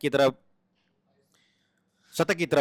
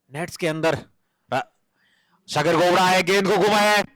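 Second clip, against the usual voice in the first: normal talking voice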